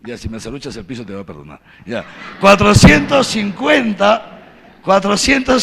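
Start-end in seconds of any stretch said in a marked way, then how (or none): none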